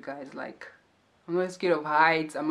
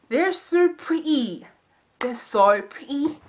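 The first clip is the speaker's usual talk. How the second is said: dreamy gushing; 8 semitones higher, 5 dB louder, slower than usual. Acted. Gushing about unicorns.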